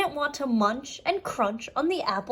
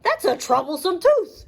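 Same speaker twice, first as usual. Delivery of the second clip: higher, bolder farmyard bull; 7 semitones higher, 4 dB louder than usual. Acted. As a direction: announce it like a proud bull. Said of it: "said Basil the bull".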